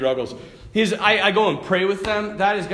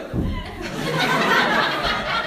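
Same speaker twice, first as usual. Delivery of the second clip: put-on kid's giggle. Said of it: "he's like".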